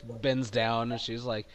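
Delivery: ordinary talk, nothing marked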